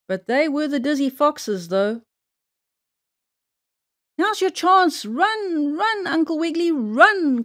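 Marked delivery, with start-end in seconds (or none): 4.2-7.4 high, urging call to a friend; urgent cry. A narrator's reading. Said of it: "cried Fido Flip-Flop".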